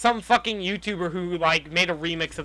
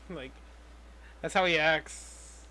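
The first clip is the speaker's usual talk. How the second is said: ordinary talk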